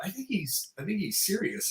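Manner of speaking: high, hushed aside to yourself; acted private disbelief; incredulous inner thought. Acting out his amused thought.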